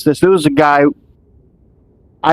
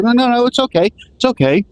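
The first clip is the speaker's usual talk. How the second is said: reassuring mom; 8 semitones higher than usual. Retold or imitated. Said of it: "She's like".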